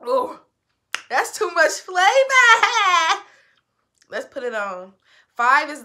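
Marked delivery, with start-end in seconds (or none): 1.1-3.2 laughing. Real, not acted. Overwhelmed by strong flavor.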